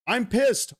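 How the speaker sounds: sudden high burst of real anger; pissed off. Real, not acted. Streamer venting to chat, pitch jumping with real anger. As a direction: let the frustration spike out.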